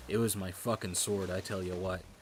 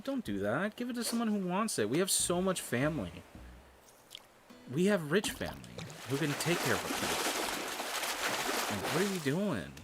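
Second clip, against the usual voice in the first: high-pitched, incredulous pleading; exasperated protest; 7 semitones higher than usual. Retold or imitated. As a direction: replay an indignant argument with a parent. Acts out protest to mom.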